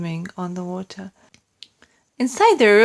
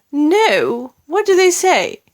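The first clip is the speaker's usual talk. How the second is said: queen; 8 semitones higher, 5 dB louder than usual. A narrator's reading. Queen asks.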